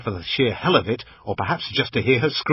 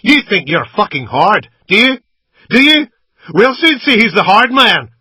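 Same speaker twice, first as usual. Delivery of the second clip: high, loud sneering shout; taunting yell; 11 semitones higher, 12 dB louder than usual. A narrator's reading. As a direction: gunman taunting the pinned captive. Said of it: "He said".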